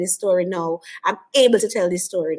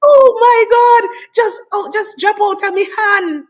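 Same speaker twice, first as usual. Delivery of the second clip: much higher and louder, a retold panic; shocked exclamation; 10 semitones higher, 11 dB louder than usual. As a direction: relive the horror of the drop. Reliving the dropped chemical.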